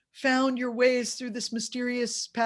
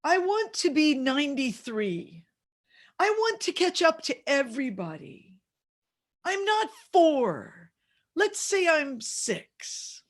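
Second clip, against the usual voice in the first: mock-tearful little kid pleading, pitched up; tearful four-year-old; 6 semitones higher, 4 dB louder than usual. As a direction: imitate a small boy insisting, slowing at the end.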